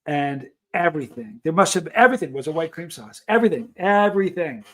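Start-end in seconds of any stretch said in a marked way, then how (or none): none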